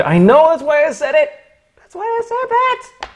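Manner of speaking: very high-pitched protest; mock defensive squeak. Streamer answering criticism of joke, squeaky mock defensiveness. Joking reply to viewer's criticism.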